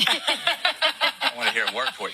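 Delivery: laughing through the words, high and slow; real laughter breaking into the line; amused, laughing as he tells it. Real, not acted. Laughing while telling the story.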